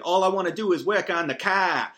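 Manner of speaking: hammed-up Boston accent on the teen lead's line. Ren McCormick's line in a sudden put-on Boston accent. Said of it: "remembers that he has a Boston accent".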